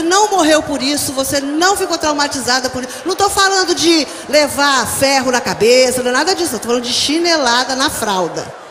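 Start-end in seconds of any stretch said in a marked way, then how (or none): 3.0-8.6 loud, forceful emphasis; preaching shout. Real, not acted. Preacher insisting to her congregation, loud and forceful. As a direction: drive the point home to the crowd.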